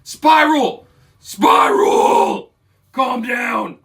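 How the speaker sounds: high, loud desperate scream. Acted. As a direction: scream a name, then ease slightly into an order. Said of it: "he screams".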